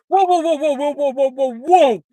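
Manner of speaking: high, loud backing-off cries; startled whoas. Acted. Loki fending off the kissing boggart, alarmed whoas. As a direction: back away in alarm. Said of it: "he says".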